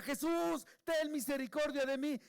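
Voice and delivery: high, loud plea for mercy; loud imploring cry, pitched well up. Retold or imitated. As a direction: shout it out like a blind beggar desperate to be heard. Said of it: "y gritaba".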